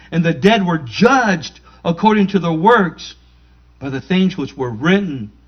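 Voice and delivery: loud scripture reading; preaching. Acted. Pastor proclaiming Revelation loudly to the congregation. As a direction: proclaim the verse to the church.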